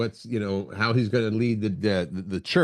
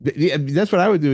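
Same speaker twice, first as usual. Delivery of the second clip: ordinary talk, nothing marked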